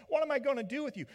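high, fast exasperated complaint; exasperated Pilate. Retold or imitated. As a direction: throw up your hands at the prisoner. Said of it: "But Pilate's like".

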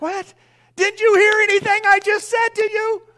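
loud, high mock outburst at the kids; a hammed-up "weren't you listening?!"; exasperated parent.

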